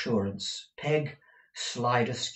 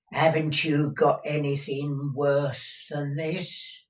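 put-on drawn-out, grumbling old servant; grudging old housekeeper; 6 semitones higher, 4 dB louder than usual. A narrator's reading. Deaf Peg objecting to master's suit, grudging. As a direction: disapprove at a measured pace.

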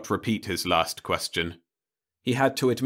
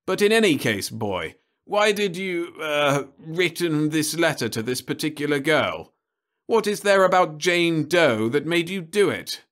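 higher, louder questioning; demanding official; 8 semitones higher, 4 dB louder than usual. Acted. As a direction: press the boy for reasons. Crumbine questions the boy.

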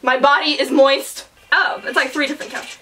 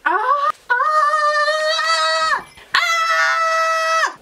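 excited scream; much slower than usual. Real, not acted. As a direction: shriek with nervous excitement. Excited scream before video.

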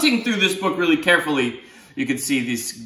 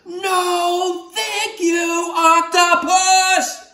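high, sung-out cross refusal with a slow drawn-out octopus; angry singsong; 4 dB louder, much slower than usual. Acted. Angry boy telling off the octopus, high singsong. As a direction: sing it out mad, stretching the last word. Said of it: "He's so mad now".

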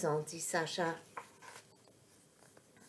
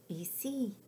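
very soft answer; whispered reply; 9 dB quieter than usual. A narrator's reading. Hidden Stella answering Sacha, whispering softly. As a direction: answer softly from a hiding place. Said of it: "chuchote Stella".

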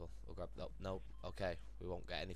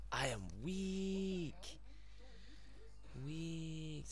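mock feeble; 7 semitones higher, much slower than usual.

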